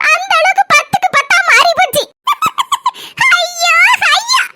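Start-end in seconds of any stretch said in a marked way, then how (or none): 2.2-3.3 scornful high laugh; mocking laugh. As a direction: burst out laughing at her. Laughing at the chain boast.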